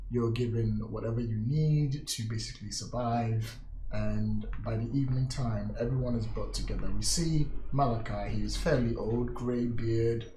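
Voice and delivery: story narration. Acted. GM scene setting.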